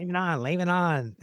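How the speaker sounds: imitating a friend's New England accent; Craig's New England accent. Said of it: "That's the New England accent there".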